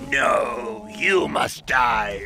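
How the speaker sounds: slow, loud threat; menacing shout. Acted. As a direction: bellow it slowly at the enemy. Gamer yelling at enemy.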